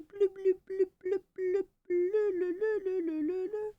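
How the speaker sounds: high-pitched playful bloop sounds. Pretend. Streamer chirping high silly bloops at her cat.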